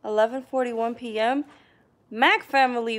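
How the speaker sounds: normal talking voice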